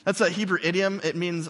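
ordinary delivery

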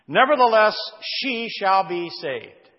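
loud "Nevertheless", then "she shall be saved" very slow; emphatic stress. Shown as a demonstration. Preacher stressing a contrast to listeners, "Nevertheless" punched, "she shall be saved" drawn out. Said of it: "the emphasis on the fact".

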